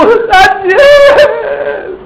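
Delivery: high, drawn-out wailing shout; crying call. Shown as a demonstration. King calling to Daniel at the lions' pit, tearful wail. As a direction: wail the name out as if in tears. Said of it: "con voz triste. ¿Cómo, cómo sería? O sea, él estaba llorando".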